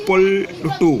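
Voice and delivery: পল্টু said slowly with the tongue curled back for ল; পল্টু sounded out with a retroflex ল; demonstrating a word. Shown as a demonstration. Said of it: "এটাও দেখো লটা". (পল্টু শব্দটা স্পষ্ট করে উচ্চারণ)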